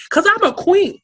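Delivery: high, loud and proud, crowning herself; proud queen declaration. Real, not acted. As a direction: announce yourself as royalty, soaring up.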